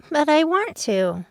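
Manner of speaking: put-on high, slow teen girl voice; pleading young girl, drawn out; Eiko's voice. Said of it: "Eiko said".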